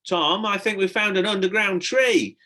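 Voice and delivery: high, loud excited yell. A narrator's reading. Stella to Tom, finding the tree, yelling. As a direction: yell the discovery up to him. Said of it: "she yelled".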